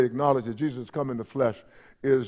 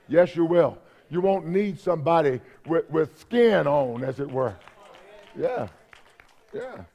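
normal talking voice

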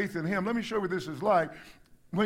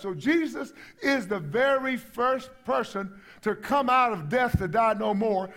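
ordinary talk, nothing marked

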